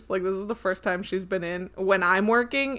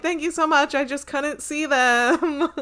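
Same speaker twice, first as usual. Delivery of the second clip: effusive, high-pitched thank-you, retold; gushing thanks; 6 semitones higher, 5 dB louder, slower than usual. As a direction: imitate her high, louder gush. Acting out a grateful stranger's thanks.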